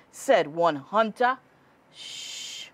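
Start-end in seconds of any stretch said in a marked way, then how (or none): 1.9-2.7 a hushed warning shush; whispered shh. A narrator's reading. Hunter hushing the others, whispered shh. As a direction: hush them before the deer hears. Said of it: "said another, "he'll hear us".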